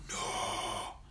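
a quick hushed refusal; whispered no. Acted. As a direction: Mandarina whispers back no to her friend. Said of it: "Y Mandarina dijo".

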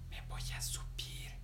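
hushed plotting whisper. Acted. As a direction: Patinina whispers her plan, a secret whisper. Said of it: "Y Patinina dijo".

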